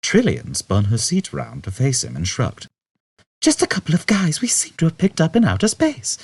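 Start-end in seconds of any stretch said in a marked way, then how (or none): 3.4-6.2 lightly higher, casual woman's voice; young woman, offhand. A narrator's reading. Trillian shrugging off Zaphod's question, casual. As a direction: shrug it off breezily.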